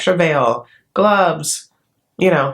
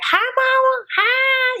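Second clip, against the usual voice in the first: much higher, sugary hello; gushing greeting; much slower than usual. Retold or imitated. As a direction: coo it like greeting a beloved mother-in-law. Greeting the mother-in-law.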